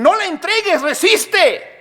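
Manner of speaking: high-pitched rallying cry; acted crowd of prophets, insistent and high; urgent exhortation. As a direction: urge them hard to hold out. Many prophets urging resistance.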